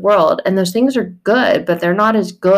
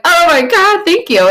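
much higher, louder gushing thanks; mock overjoyed thanks; 11 semitones higher, 5 dB louder than usual. Believer thanking Jesus, gushing and high. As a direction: squeal with grateful delight. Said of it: "you're like".

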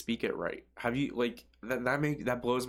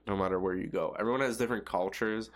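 ordinary delivery

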